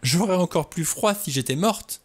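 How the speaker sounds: high, near-hysterical retort; high-pitched, overwrought comeback; hoarse laughing retort. A narrator's reading. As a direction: answer with bitter, agitated irony. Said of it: "avec un rire rauque".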